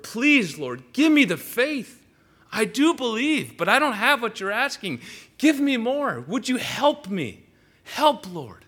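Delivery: high, loud pleading prayer; desperate cry. Acted. Believer praying desperately to the Lord for faith, loud plea. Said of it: "It's this desperate cry".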